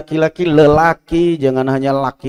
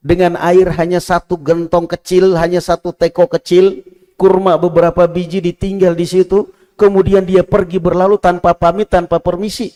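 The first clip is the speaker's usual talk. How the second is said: rising, louder sermon storytelling; preaching at full force; 7 semitones higher, 5 dB louder than usual. Acted. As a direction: drive the story home to the congregation.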